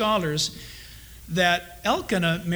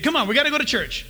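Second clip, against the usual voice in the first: 1 hurried parent; 6 semitones higher, much faster than usual. Retold or imitated. Parent hurrying the family.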